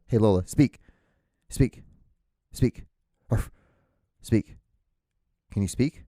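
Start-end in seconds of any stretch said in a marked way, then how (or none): 0.1-2.9 louder command to the dog; firm repeated dog command; calling the dog. Real, not acted. 3.3-3.5 single mock dog bark; a playful "arf" to prompt the dog; imitated bark. Shown as a demonstration.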